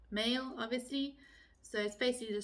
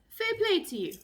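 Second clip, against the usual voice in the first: high, loud bright praise; cheery Irish praise; 12 semitones higher, 6 dB louder than usual. Shown as a demonstration. Praising a friend's good job, loud and high. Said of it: "if someone says".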